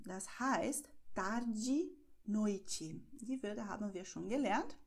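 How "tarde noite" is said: tarde as tardi, noite as noiti; final e as i. Shown as a demonstration. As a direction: end tarde and noite on an i sound. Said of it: "E am Wortende wird wie I ausgesprochen".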